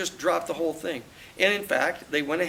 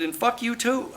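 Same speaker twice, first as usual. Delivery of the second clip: walking out on the lawyer with a curse; parting curse; 8 semitones higher than usual. Retold or imitated. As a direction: toss the curse back defiantly.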